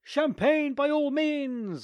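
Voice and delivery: high, slow, delighted; bright eager yes; eager acceptance. Acted. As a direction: exclaim it gladly.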